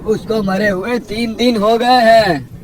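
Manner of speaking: high, projected, incredulous uncle; exasperated village kaka voice; scolding village elder. Acted. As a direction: set a foolish neighbour straight.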